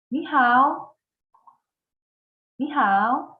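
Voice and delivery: 你好 drawn out slowly with clear tones, pausing for echoes; slow model for repeating. Shown as a demonstration. Teacher to class: slow drill to repeat. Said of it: "repeat after me".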